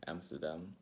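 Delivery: ordinary talk, nothing marked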